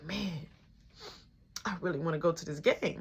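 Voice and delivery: muttering to self. Retold or imitated. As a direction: sulk quietly alone. Sulking alone in room.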